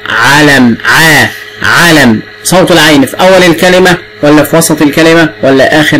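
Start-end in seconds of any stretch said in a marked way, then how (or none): 0.1-2.2 ع held, then علم drawn out; teacher telling the child to listen closely; slow sounding out. Shown as a demonstration. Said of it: "خلي بالك".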